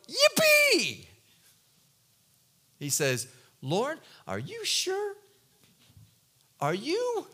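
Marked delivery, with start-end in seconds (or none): 0.1-1.1 squeaky, very high cheer; sarcastic pretend glee; mock yippee. Ananias pretending to cheer at suffering, very high. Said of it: "Ananias doesn't go". 3.6-7.3 higher, slow, nervous question; hesitant, worried asking; doubtful tone. Retold or imitated. Ananias nervously questioning God, higher and slow. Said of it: "you can hear it in the tone".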